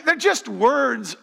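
high, loud and drawn out; loud dismissive protest. Acted. Preacher dismissing spoken words to the congregation, loud protest. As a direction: protest it high and slowly, at volume.

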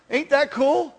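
put-on high, loud twangy country kid; corny country-song twang. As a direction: play an eager boy with country twang. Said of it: "You gotta say it with a twang".

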